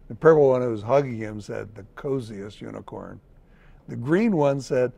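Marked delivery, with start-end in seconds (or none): 1.8-3.2 tender. A narrator's reading. Purple crayon hugging him.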